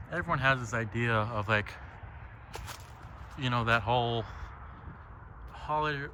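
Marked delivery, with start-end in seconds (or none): none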